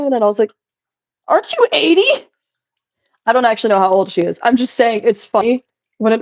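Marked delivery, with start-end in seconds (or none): 1.3-2.3 much higher, incredulous teasing; mock disbelief. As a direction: squeak it out in disbelief. Retold reply to old lady.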